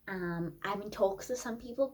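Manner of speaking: usual speaking voice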